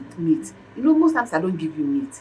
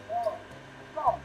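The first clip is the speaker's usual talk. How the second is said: ordinary delivery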